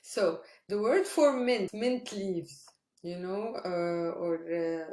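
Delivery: ordinary talk, nothing marked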